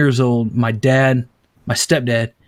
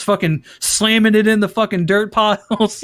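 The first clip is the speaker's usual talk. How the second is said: usual speaking voice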